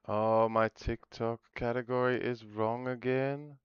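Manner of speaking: put-on slow, soft baby talk; babyish whine. Streamer whines to chat in slow baby talk. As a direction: pout it out like a toddler.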